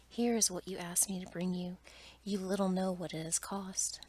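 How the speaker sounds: plain reading voice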